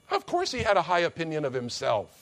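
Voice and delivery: usual speaking voice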